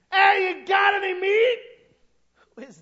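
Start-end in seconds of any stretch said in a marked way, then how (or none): 0.1-1.6 high, loud shout across the water; calling out. Acted. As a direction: call to the boat from the shore. Jesus calling from shore.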